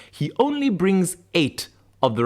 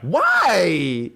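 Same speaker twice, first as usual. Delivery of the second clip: high, wailed question; mock dismay; 6 semitones higher, 3 dB louder than usual. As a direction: protest the harshness in a pitched-up cry. Acted shocked reaction to the insult.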